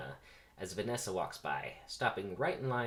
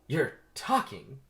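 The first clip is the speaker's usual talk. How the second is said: slow, raised, louder repeat; stunned disbelief; 5 semitones higher, 5 dB louder, slower than usual. Acted. Vanessa still stunned that the bee speaks, incredulous. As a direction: draw it out, unable to believe it.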